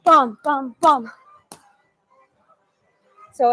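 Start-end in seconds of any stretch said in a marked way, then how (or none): none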